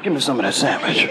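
low, rumbling divine demand; deep voice of God. Retold or imitated. God's voice speaks to the preacher, low. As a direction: boom it out like God himself.

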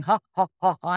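mock "ha ha ha" laugh; spelt-out laughs, imitating a whole group; imitated laughter. Teller imitates teammates laughing at her, mock laughter. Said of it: "they're all laughing".

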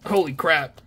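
ordinary talk, nothing marked

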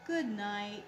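drawn-out sleepy goodnight; sleepy yawn. A narrator's reading. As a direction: let it sink drowsily, as if dozing off. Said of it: "yawned Possum".

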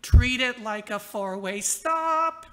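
slow, loud imagined yell at drivers; mock inner scream. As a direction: spell it out loudly and slowly to the clueless drivers. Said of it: "you scream".

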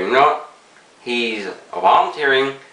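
speaking around clenched pipe stem; talking with pipe in mouth. Real, not acted. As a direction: lips tight around pipe. Seen 1: Pipe stem held between teeth throughout; only in hand before/after.

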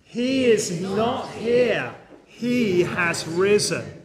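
slow, emphatic chorus leader; congregational recitation. Shown as a demonstration. Preacher has everyone say it again together, slow recitation. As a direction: stretch every word so all can join in. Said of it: "Say it again".

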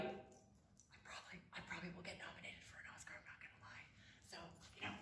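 confiding a smug boast in a whisper. Pretend. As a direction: lean in and whisper the secret. Secret boast in acceptance speech.

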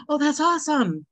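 gasp, then high delighted praise; surprised gasp. Real, not acted. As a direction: gasp and light up. Gasps at friend's news.

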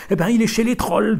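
loud, high curt reply; blunt loud answer. A narrator's reading. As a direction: throw it at her bluntly.

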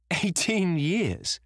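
very high, drawn-out exclamation; elated, incredulous high cry; triumphant. A narrator's reading. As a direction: crow it slowly with a touch of triumph. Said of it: "laughed Dorian Gray, with a touch of triumph in his voice".

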